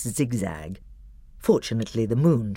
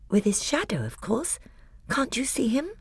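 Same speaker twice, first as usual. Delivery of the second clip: put-on higher, softer girl's voice; a little sister, quietly certain; Lucy; 11 semitones higher, 3 dB quieter than usual. A narrator's reading. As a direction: gently insist he is there. Lucy's voice, softer.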